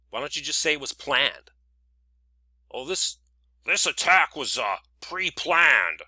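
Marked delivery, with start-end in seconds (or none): 2.7-6.0 slow, emphatic mock announcement; mimicking people who say it. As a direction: parody someone making a weighty pronouncement. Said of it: "When people say something was pre-planned".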